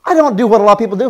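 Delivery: ordinary talk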